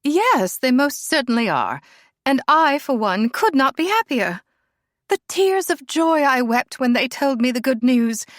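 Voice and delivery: loud, high mock delight; hammed-up gushing mother. A narrator's reading. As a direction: gush over the engagement to annoy her. Helena gushes with mock joy.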